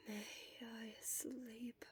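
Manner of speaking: too exhausted to speak aloud; faint whisper. A narrator's reading. Exhausted Shota asking the nurse to sleep, whispered slowly.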